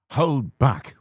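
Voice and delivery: plain reading voice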